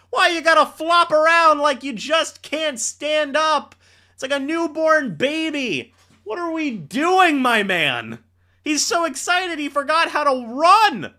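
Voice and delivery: high, loud, teasing disbelief; mocking rant. Real, not acted. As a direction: roast him for flopping around. Mocking player for falling.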